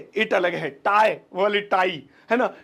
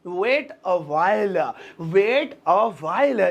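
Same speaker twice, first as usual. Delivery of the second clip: very slow, stretched words; slow teaching repeat; slow drill; 4 semitones lower, much slower than usual. Shown as a demonstration. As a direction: lay it out slowly for learners. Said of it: "इसे English में बोलेंगे".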